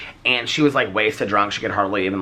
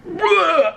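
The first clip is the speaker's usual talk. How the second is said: high, loud mock vomiting noise; imitated retch; 5 dB louder than usual. Imitating a guy on the street corner, loud retching bleh. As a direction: blurt out a mock puke sound. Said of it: "and he just goes".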